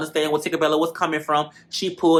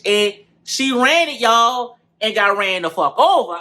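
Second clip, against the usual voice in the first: ordinary talk, nothing marked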